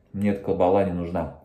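talking while chewing bread; words slightly muffled by food; mouth partly full. Real, not acted. Seen 2: Bites bread at -2 to -1s, then talks still holding it.

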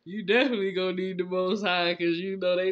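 ordinary talk, nothing marked

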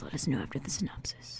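hushed whisper. Real, not acted. Telling Jamie to save questions, in a whisper. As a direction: breathe it as a secret aside. Aside to Jamie before synopsis.

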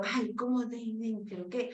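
softly muttered self-questioning; searching one's memory. Acted. Teacher acting a student searching their head, muttered. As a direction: think aloud under your breath, puzzled. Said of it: "si tú estás buscando".

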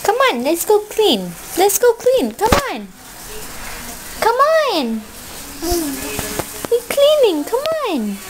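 high, swooping singsong. Real, not acted. Mother urging baby to go clean, high singsong coaxing. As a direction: coax a little one along in singsong.

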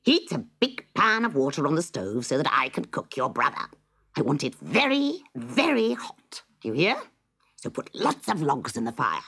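high, stretched "very, very hot" witch order; bossy witch. Acted. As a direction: order the girl about, dragging out the heat.

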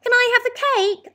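put-on very high, loud child's voice; eager boy. A narrator's reading. Lester asking his mum for cake, high and eager. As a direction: ask greedily like a small boy.

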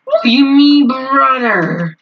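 high, loud meme whine; hammed-up indignant cry; mock outraged yell. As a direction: wail it like a meme catchphrase. Meme catchphrase yelled at teammate.

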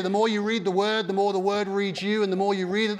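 ordinary talk, nothing marked

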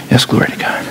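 subdued, perfunctory praise; mock a composed angel with no excitement; unexcited angel. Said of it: "I just don't see angels standing there going".

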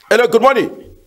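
usual speaking voice

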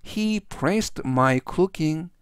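very slow, careful English reading; slow model sentence. Shown as a demonstration. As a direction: spell out each word slowly for students. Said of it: "전체적 의미는". (아주 천천히 또박또박 읽는 예문)

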